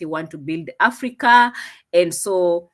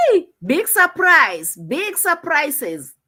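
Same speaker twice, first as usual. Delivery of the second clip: hyped announcing; 7 semitones higher, 4 dB louder, slower than usual. Real, not acted. Announcing a surprise donation.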